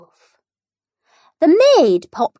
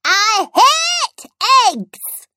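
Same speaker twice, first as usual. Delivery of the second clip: put-on high, whiny little girl; little princess; 12 semitones higher, slower than usual. A narrator's reading. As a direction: whine it like a small girl. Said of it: "said the little princess".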